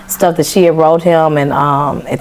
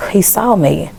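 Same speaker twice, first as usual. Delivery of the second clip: whispered in a panic; slower than usual. Retold or imitated. Caught by the director, panicked whisper. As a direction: whisper it so he won't hear.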